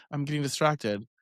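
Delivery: normal talking voice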